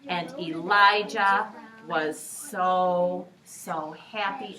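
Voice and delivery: slow, loud "so"; stretched joyful build. A narrator's reading. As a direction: draw out the joy. Happy build-up.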